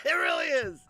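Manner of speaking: very high, loud laughing squeal. Real, not acted. Owning up to stolen jokes, laughing squeal.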